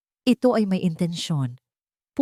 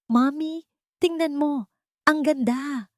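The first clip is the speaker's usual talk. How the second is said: put-on high, bright child voice showing off her work; a small daughter calling her mom proudly; excited child, much higher pitch; proud little girl; 10 semitones higher than usual. A narrator's reading. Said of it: "Proud na sabi ni Maya".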